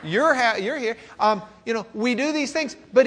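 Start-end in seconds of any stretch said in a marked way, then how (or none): none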